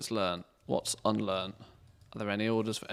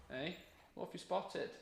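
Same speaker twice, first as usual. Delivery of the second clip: high, soft voice for the dog; talking to the dog; 6 semitones higher, 16 dB quieter than usual. Real, not acted. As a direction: coax the dog gently, pitched up.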